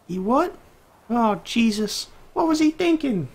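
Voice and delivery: very high falsetto exclaiming from afar; exasperated distant mom. Acted. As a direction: cry out like an appalled mom in another room. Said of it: "that's what her mom sounds like in the distance".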